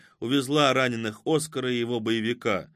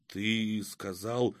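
slow, hushed disbelief; stunned; 6 dB quieter, much slower than usual. A narrator's reading. Oskar turning to Ignat, stunned and quiet. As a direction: draw the words out softly, astonished. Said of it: "Изумлённо повернулся к нему Оскар".

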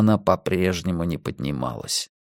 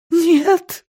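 very high sobbed refusal; anguished high cry; sobbing; 3 dB louder than usual. A narrator's reading. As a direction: burst into a wail. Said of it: "зарыдала Силья".

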